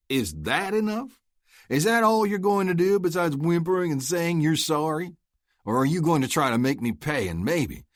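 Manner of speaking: loud, high mocking taunt. Luke goads Oscar into charging, taunting. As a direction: lay the taunt on thick. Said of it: "really laying on the taunting tone".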